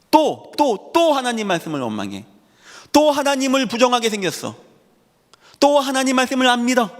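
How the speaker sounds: high-pitched, insistent reproach hammering each repetition; exasperated lament. Acted. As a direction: cry out in dismay at their unbelief. Preacher laments repeated unbelief.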